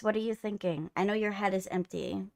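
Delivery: usual speaking voice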